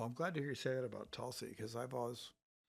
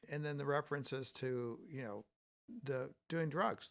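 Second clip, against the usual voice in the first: ordinary talk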